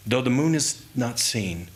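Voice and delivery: plain reading voice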